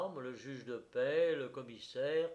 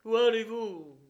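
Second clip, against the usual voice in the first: high, loud demanding cry; shouted challenge; 8 semitones higher, 12 dB louder than usual. A narrator's reading. A man clinging to the coffin challenges its bearers, shouting. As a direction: cry out to stop them. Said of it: "cria-t-il en s'accrochant au cercueil".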